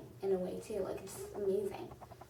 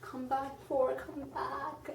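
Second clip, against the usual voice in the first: pleading; 5 semitones higher, slower than usual. Retold or imitated. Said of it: "just, like".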